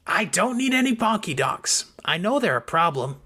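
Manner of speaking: put-on childlike Snowdrop, matter-of-fact; small childlike Snowdrop. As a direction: say it like a child refusing a treat.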